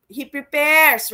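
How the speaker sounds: slow, loud "he prepares" with weight on prepares; preacher stressing the verse; emphatic. Real, not acted. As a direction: lean hard on prepares.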